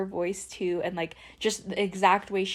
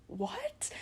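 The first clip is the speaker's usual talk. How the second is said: high, soft whispered what; scared whisper; 10 dB quieter than usual. Retold or imitated. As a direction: breathe the word out, spooked. Scared in empty house.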